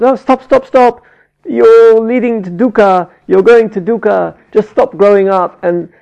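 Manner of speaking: loud, high mock-urgent pleading; urgent plea. Wishing to warn a child off dukkha, urgently pleading. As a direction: plead as if halting a child in danger.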